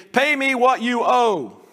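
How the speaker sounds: slow, high, loud threat while choking him; menacing demand. Acted. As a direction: squeeze the words out like a strangler. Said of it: "he's choking the guy".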